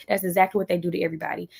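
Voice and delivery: ordinary delivery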